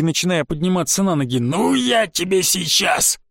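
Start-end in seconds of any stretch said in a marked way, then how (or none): 1.4-3.2 high, drawn-out furious threat; threatening cry. A narrator's reading. Грабонги getting up to attack, shouting a threat. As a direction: threaten him, dragging out every word. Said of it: "вскрикнул Грабонги".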